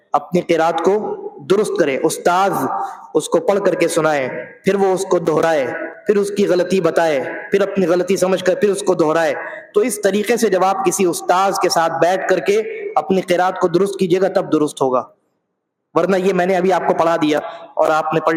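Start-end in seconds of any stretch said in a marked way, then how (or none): none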